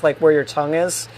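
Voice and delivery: ordinary delivery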